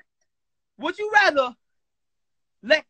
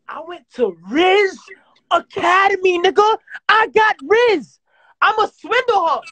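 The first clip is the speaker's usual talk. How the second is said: loud, high indignant brag; loud boasting; 4 semitones higher, 7 dB louder than usual. Real, not acted. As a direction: proclaim your skills, loud and raised. Boasting loudly in mock argument.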